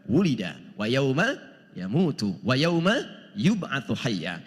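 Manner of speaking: slow, low chanted Arabic verse; Quran recitation. Acted. Preacher reciting the verse to the congregation, slow chant. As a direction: intone the verse slowly like tilawah.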